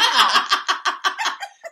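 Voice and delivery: big high laugh; loud laugh. Real, not acted. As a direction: burst out laughing loudly.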